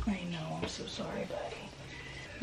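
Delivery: hushed comforting whisper. Real, not acted. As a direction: soothe him in a whisper. Comforting sick child.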